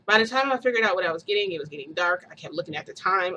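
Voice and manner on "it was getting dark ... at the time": ordinary talk, nothing marked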